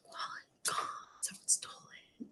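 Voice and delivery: hushed whisper with the wallet word first. Shown as a demonstration. Teacher whispering the topic-first version. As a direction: breathe it out like a shocked secret. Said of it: "how you would sign it".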